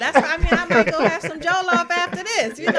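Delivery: laughing through the words; real laughter breaking through. Real, not acted. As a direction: giggle it out while talking. Laughing while talking.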